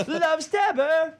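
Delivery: high, very slow and stretched; high drawn-out mock voice. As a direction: drag it out in a silly high voice. Repeating the catchphrase in a voice.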